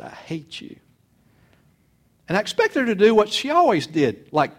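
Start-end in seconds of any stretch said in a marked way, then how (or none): 0.0-0.7 retold teenage outburst, whispered; whispered hate. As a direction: breathe the words, bitter and soft. Teen son retells hating mother.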